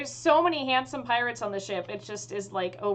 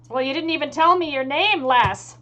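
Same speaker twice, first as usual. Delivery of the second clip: loud, higher pirate challenge; pirate captain; 4 semitones higher, 8 dB louder than usual. Acted. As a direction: demand it as a hearty pirate captain. GM voices pirate captain demanding name.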